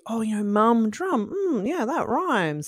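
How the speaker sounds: put-on light childlike voice, pitch up; a hint of a kid noticing a rhyme; imitating a child. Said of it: "kids will often say".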